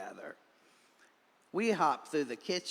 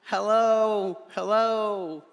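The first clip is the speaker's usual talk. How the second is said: high, loud calling hello; cheery high hello to all the animals; excited greeting; 8 semitones higher, 9 dB louder than usual. A narrator's reading. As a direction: call out to the whole garden.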